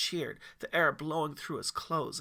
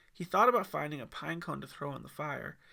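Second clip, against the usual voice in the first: ordinary delivery, nothing marked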